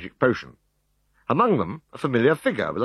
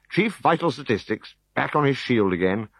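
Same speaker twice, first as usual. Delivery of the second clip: ordinary delivery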